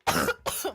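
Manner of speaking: hard throat-clearing cough; loud cough. Real, not acted. Throat trouble while reading.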